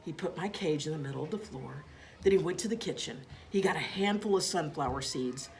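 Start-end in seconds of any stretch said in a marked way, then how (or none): none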